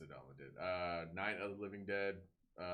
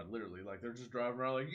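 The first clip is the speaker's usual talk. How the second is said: ordinary delivery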